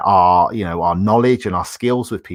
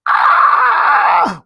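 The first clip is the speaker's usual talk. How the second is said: a sudden very high, loud cry of alarm; mock panic shout; 8 dB louder than usual. Host acting out panic at a fire alarm, sudden alarmed yell. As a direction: yelp like the fire alarm just went off.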